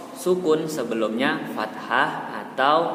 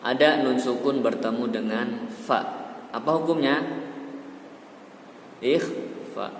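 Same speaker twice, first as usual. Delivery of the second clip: ordinary talk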